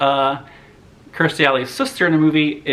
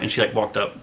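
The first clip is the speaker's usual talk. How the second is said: normal talking voice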